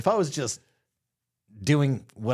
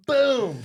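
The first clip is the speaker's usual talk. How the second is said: mock impact boom; 12 semitones higher, 5 dB louder than usual. Retelling the shove.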